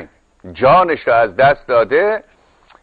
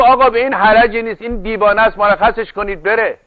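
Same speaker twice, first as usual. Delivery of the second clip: lightly acted judge, pitched higher; brisk, higher-pitched dismissal, retold; reluctant judge; 6 semitones higher than usual. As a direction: imitate a judge waving a case away. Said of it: "قاضی دلش نمیخواست اینا رو بکشن".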